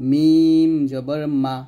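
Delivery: slow, drawn-out "মিম জবর মা", lips pressed on the dry part; slow letter demo. Shown as a demonstration. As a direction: spell out "মিম জবর মা" slowly, lips closed, then open on "মা". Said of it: "দুই ঠোঁটের শুকনো অংশ থেকে মিলাই উচ্চারণ করব". (ধীরে "মিম জবর মা" উচ্চারণ)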